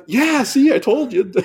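high, gloating told-you-so. Retold or imitated. Diver gloating at the friend who got bit, high gloat. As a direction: rub it in playfully. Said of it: "I'm like".

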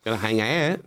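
playful put-on Aussie accent; Australian accent. Comic riffing on leaving Perth, Australian accent. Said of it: "do a really good Australian accent".

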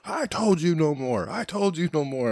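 scolding the shadow people in a retold yell. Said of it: "I'm, like, yelling with these".

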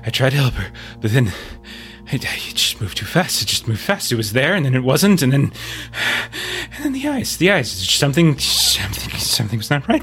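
frantic breathless rush, whispered bits; terrified man gasping between phrases; panicked, hyperventilating. Acted.

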